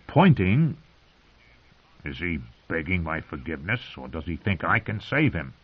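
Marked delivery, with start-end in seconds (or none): 2.0-5.5 hushed inner thought. Acted. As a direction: murmur it to yourself.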